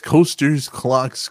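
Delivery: ordinary delivery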